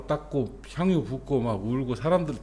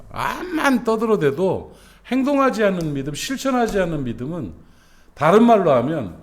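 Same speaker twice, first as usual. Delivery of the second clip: higher, louder fervent preaching; full-force preaching; 7 semitones higher, 5 dB louder than usual. Acted. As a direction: drive the point home to the congregation.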